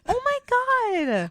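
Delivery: high, shocked oh my God; exaggerated outrage at her brother; mock exasperated. Retort to brother's teasing.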